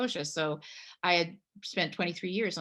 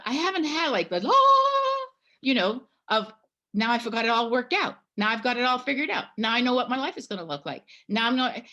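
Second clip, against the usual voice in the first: higher, louder and rushing; frustrated outburst; 6 semitones higher, 5 dB louder, faster than usual. Retold or imitated. Telling the hospitalier she hasn't had her epiphany, rushing and raised. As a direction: spill it out fast, half laughing at yourself.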